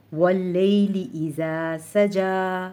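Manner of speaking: very slow recitation, सजा ending in held aa; unchanged long vowel. Shown as a demonstration. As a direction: recite slowly, stretching the end of सजा. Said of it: "ये भी ऐसे ही पढ़ा जाएगा". (सजा की खड़ी ज़बर खींचकर पढ़ें)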